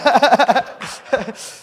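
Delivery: hearty loud laughter. Real, not acted. Pastor laughing at his own story, loudly. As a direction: burst out laughing.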